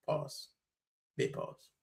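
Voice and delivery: breathy whisper, no voice; whispered aside. Real, not acted. Whispering a chat joke about a long pause. Reading a chat message aloud.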